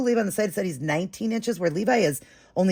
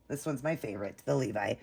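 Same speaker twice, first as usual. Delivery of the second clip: usual speaking voice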